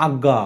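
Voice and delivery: "আজ্ঞা" as ag-ga; teacher's model "আজ্ঞা", g held across syllables; doubled g. Shown as a demonstration. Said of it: "আমরা গ আর গ পড়ি".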